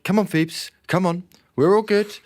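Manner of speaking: high, coaxing calm-down voice; soothing a dog. Retold or imitated. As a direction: gently coax the dog.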